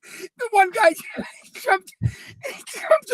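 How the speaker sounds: words squeezed out high through laughter; can barely get it out for laughing. Real, not acted. Telling his friend about the ad, laughing high and breathless. Laughing while retelling the commercial.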